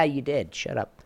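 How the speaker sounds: ordinary delivery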